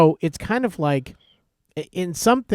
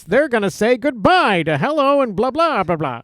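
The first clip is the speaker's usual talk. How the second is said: high, peaky newsreel patter; mock vintage announcer spiel; 7 semitones higher, 3 dB louder than usual. Shown as a demonstration. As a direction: rattle on like an old newsreel man. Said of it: "the newsreels are very famous for those".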